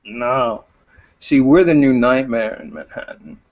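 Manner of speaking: lightly voiced gloating leader, chuckles between phrases; laughing villain. Game master as gang leader taunting captive, laughing villain. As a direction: taunt them with pauses and small laughs. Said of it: "And he is laughing".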